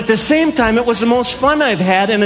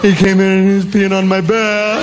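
put-on little kid voice, lower; child making excuses; 4 semitones lower than usual. Child blames cat for wet bed, mock contrite. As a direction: imitate a kid telling a tall tale.

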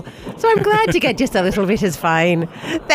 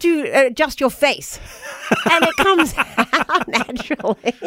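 English accent, adjusting the face for it; playful demo of a proper English accent; 2 semitones higher than usual. Shown as a demonstration. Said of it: "Could you do the English accent".

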